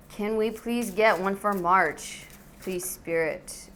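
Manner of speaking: usual speaking voice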